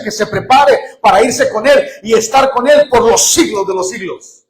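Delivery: loud, high preaching at full force. Real, not acted. Pastor to his congregation, preaching at full force. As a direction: proclaim it to the whole church.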